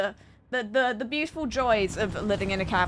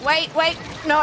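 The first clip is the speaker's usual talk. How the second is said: panicked; 6 semitones higher, 4 dB louder than usual. Real, not acted. Panicking mid-round.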